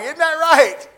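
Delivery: loud, high joking check; jovial call-out. Retold or imitated. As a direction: holler it playfully for agreement. Acts out joking with a man.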